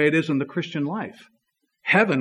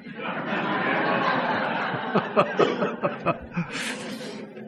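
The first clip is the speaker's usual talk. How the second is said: laughing; 11 semitones higher, 4 dB quieter than usual. Real, not acted. Laughs at own joke.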